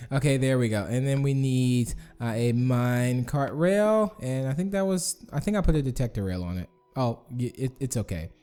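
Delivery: normal talking voice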